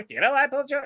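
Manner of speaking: very high squeaky hamster repeat; squeaky hamster echo. Retold or imitated. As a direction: parrot it back like a toy hamster. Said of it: "everything the cop says".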